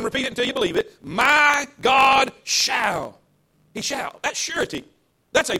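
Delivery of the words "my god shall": slow, loud, stretched-out proclamation; leading the church in a loud, drawn-out declaration; declared loudly. Shown as a demonstration. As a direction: stretch each word and shout it out. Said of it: "Come on, say it again".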